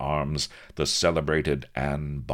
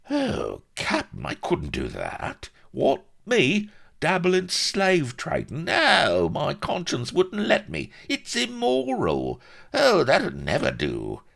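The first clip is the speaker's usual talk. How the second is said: high, squeaky mock outrage; shifty con man; 4 dB louder than usual. A narrator's reading. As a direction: feign shocked virtue. Vladimir protesting.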